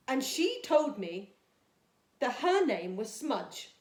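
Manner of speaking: put-on storytime reading for young children; gentle storybook read-aloud for Year two; reading a story. A narrator's reading.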